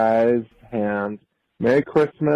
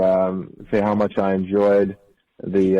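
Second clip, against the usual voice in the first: ordinary delivery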